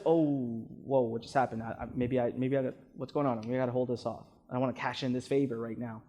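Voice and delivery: low, quiet, worried mumble; subconscious spooked when the tone drops; nervous inner voice. Retold or imitated. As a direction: back off anxiously. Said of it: "the subconscious says".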